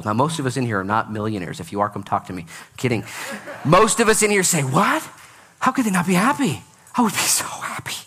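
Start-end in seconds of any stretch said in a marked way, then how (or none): 4.6-7.9 high, incredulous, ending in a whisper; mock disbelief. Pastor voicing his listeners' disbelief about unhappy millionaires, high and hushed. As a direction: scoff at the idea then breathe the wish.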